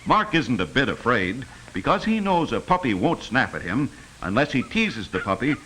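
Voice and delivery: ordinary talk, nothing marked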